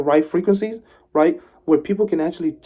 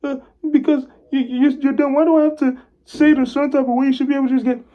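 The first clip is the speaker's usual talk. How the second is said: high, fast whiny protest; mock upset complainer; 10 semitones higher, 3 dB louder, much faster than usual. Imitating people upset at being told how to speak, whiny. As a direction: mimic a griping person, higher and quicker. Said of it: "wanna get upset and get mad".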